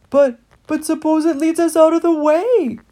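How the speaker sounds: a fretful rat doubting the path; worried voice. Acted. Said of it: "said Heathly in a worried voice".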